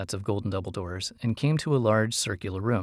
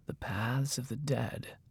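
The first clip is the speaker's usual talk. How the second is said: slow, hushed repetition; whispering voice; 2 semitones higher, 8 dB quieter, slower than usual. A narrator's reading. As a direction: repeat it softly and slowly, with weight. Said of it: "the mage repeated".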